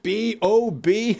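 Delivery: spelled out slowly; drawn-out letters; slow spelling. Retold or imitated. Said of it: "the guy in the audience goes".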